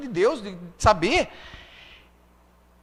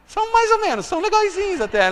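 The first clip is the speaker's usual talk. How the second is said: pitched way up, playfully grudging; higher, teasing grudging voice; mock grudging praise; 11 semitones higher than usual. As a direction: toss off a half-impressed verdict up high. Retelling his first teen-group impression.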